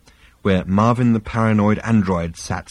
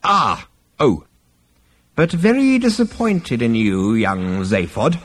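put-on disapproving old ancestor. A narrator's reading. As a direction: scold a young descendant. Ancestor rebukes his great-grandson.

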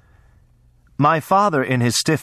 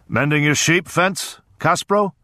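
a lightly voiced hearty landowner, a bit higher; big jovial voice; 6 semitones higher than usual. A narrator's reading. Lord greets a neighbour mending the fence, mildly jovial. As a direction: friendly boom with a hint of menace. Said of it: "in a big, unexpectedly warm and jovial voice".

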